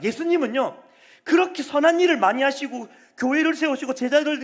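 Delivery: full-force preaching. Acted. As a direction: drive it home from the pulpit.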